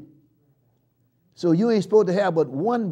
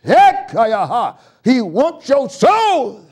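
loud, fast, high preaching cadence; whooping preacher build; preaching at full force; 7 semitones higher, 8 dB louder than usual. Real, not acted. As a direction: drive the sermon home to the church.

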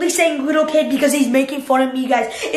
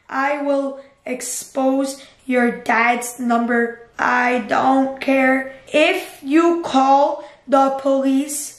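slow, deliberate words as he types; slow dictation; much slower than usual. Real, not acted. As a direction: dictate the reply slowly. Typing reply to Ryan.

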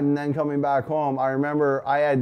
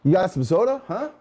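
playful Canadian "huh" at the end; teasing Canadian twang, lightly done; mock Canadian accent; 5 semitones higher than usual. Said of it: "and huh?".

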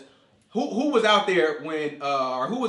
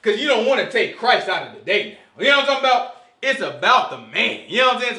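usual speaking voice

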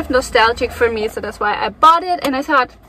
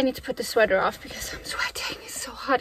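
whispering; 9 dB quieter than usual. Real, not acted. Aside while taking sweater off.

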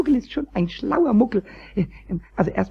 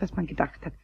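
whisper; 4 semitones lower, 11 dB quieter than usual. Acted. Musing on magic telephone.

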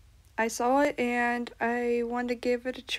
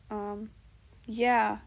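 usual speaking voice

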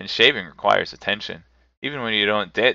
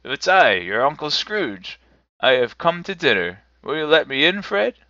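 higher, louder announcing voice; eager, hopeful Scrooge; 6 semitones higher, 5 dB louder than usual. A narrator's reading. Uncle Scrooge asks Fred to let him in, hopeful. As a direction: announce yourself, lifting into a hopeful plea.

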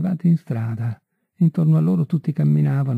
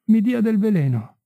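high-pitched cry, only moderately raised; narrated outburst, much higher voice; sudden cry; 9 semitones higher than usual. A narrator's reading. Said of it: "gridò improvvisamente Marie".

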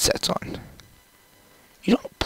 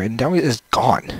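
ordinary talk, nothing marked

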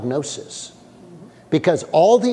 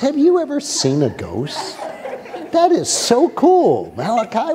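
mock curious delight; 3 semitones higher than usual. Pastor mocking disciples who would call a ghost cool, mock delighted. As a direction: play it like a tourist thrilled by a ghost, a little laugh in it. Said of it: "No, it says they cried in fear".

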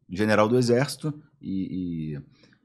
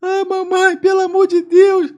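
very high, loud mock wail; hammed-up tearful falsetto; imitated crying; 7 dB louder, slower than usual. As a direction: whine for mummy like a fake crying victim. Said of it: "falou o maluco chorando".

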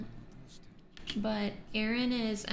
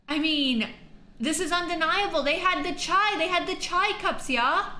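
high and loud, gushing; high loud thrilled gushing; excited; 6 semitones higher, 6 dB louder than usual. Real, not acted. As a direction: bubble over with delight. Gushing about chai cups.

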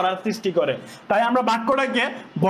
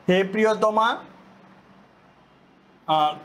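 soft lovesick recitation; 2 semitones lower than usual. Shown as a demonstration. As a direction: croon it softly like a lover at home. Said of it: "আস্তে আস্তে বলতে হবে". (ধীরে, নরম, প্রেমপত্র পড়ার সুরে)

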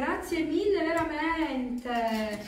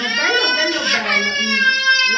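delighted; 10 semitones higher, 12 dB louder than usual. Real, not acted. Delighted at gift.

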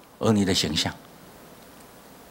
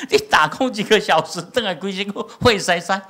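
raised, much higher scolding voice; mock scolding father; 9 semitones higher, 4 dB louder than usual. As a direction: scold the son in a high, raised voice. Pastor scolding son, retold.

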